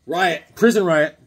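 ordinary talk

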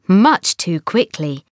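plain reading voice

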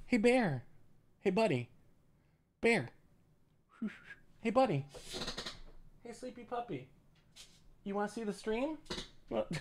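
real pet talk, hushed and high; puppy talk. Real, not acted. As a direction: gently wake the dog. Waking his sleeping puppy.

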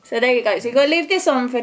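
fingers tugging at lips; laughing behind face mask; through a sheet face mask. Real, not acted. Seen 0: Sheet mask covers face, fingers adjusting mouth flap while speaking.

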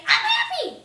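high, loud, cheery "I'm happy". Shown as a demonstration. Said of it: "so much more fun to say".

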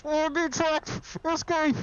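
squealing in surprise; high-pitched outburst. Real, not acted. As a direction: yelp it out high. Sudden outburst at enemy.

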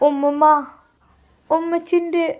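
put-on a small girl pleading, near tears; child begging grandma not to let mummy go; upset little girl. Aishu upset about mother's wedding.

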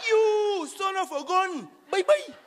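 high, loud hammed-up jeer; high, loud teasing farewell; mock shout. Mock insult then goodbye.

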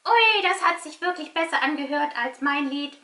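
puppet voice. Pretend. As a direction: kid puppet, bright and excited. Puppet character talks to host.

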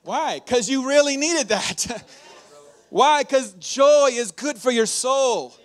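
loud, slow preacher's cry; a pastor's rising crescendo; preaching at full force. Acted. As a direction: lift the room with it. Preaching peak on joy.

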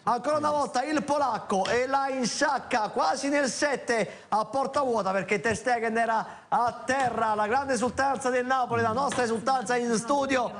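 high, excited goal recap; breathless italian goal commentary. Real, not acted. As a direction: keep the thrill running through the recap.

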